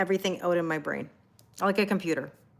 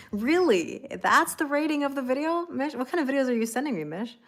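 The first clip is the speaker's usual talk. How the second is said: laughing into a higher, incredulous question; scandalized but amused, pitched up; playful shock; 6 semitones higher than usual. Real, not acted. Streamer teasing Mish over the video sent, amused disbelief.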